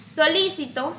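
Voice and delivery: "Solícito" stressed on LI; stress on second syllable. Shown as a demonstration. Teacher showing how accent changes meaning, stress on LI in "Solícito". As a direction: hit the LI in "Solícito". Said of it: "el acento está en distintas sílabas".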